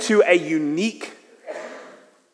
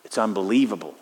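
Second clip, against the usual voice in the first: hushed, low wonder at the Astrodome; quiet awe; 9 semitones lower than usual. Retold or imitated. Preacher recalling the Astrodome, quiet hushed awe. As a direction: breathe it out in awe, softer and lower. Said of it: "and thought".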